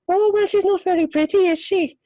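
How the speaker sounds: high, lilting Irish voice with an upward rise; a sweetly catty Irish lady, voice lifting at the end. Retold or imitated. An actress shows how an Irish lady nicely says something nasty, high lilting brogue. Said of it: "like, an Irish lady might say".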